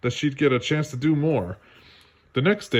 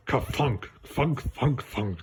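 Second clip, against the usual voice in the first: falling buildings sound; 5 dB quieter, slower than usual. Acted.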